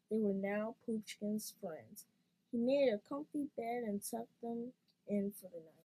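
ordinary delivery, nothing marked

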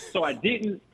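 normal talking voice

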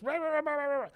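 high-pitched gibberish ranting; mock foreign gibberish. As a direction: rant nonsense syllables high and fast. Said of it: "He's speaking in Laotian".

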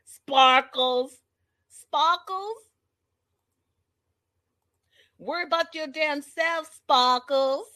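high-pitched imitation Boston accent; mock someone in a put-on Boston voice; teasing Boston drawl on a nickname. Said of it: "between the Boston accent".

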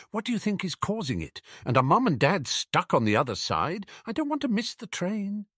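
high-pitched anxious questions; worried girl's voice, pitched high; Ginny. A narrator's reading. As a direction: fret about being left behind. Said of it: "said Ginny".

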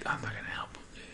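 very soft, near-whispered inner thought; muttered aside. Retold or imitated. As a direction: say it under your breath, as if privately. Voices his private refusal to help.

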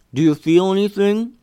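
talking through a mouthful; words round a bite of hot dog; mouth full. A narrator's reading. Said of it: "with a mouthful of hot dog".

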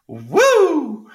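cheer. Real, not acted. Cheering herself up after confession.

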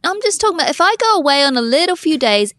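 normal talking voice

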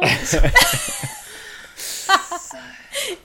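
loud, high laughing fit; real high-pitched laugh; high burst of laughter. Real, not acted. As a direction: crack up loudly. Laughing at joke.

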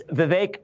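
Vivek slowly, second syllable rhyming with cake. Shown as a demonstration. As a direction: say Vivek as vih-VAKE, long a. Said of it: "It's Vivek like cake".

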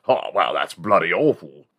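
put-on English accent, appalled; exaggerated UK accent; mock British accent. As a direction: do a Brit passing judgement in a British accent. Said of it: "everyone in the U K goes".

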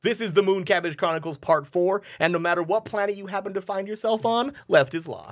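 bright, high announcer welcome; show host intro. Acted. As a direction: open the show like a radio host.

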